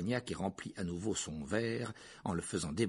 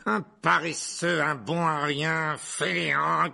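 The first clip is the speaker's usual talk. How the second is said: slow, higher, hammering each insult; loud contemptuous insults; 8 semitones higher, 10 dB louder, slower than usual. A narrator's reading. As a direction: pile up the scorn loudly. Aunt insulting the absent father. (insultes martelées avec mépris)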